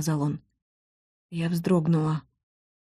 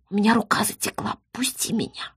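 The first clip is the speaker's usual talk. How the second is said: whisper; 5 dB quieter than usual. A narrator's reading. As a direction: still unvoiced, pleading whisper. Whispering on, pinned by him. (шёпотом, с просьбой)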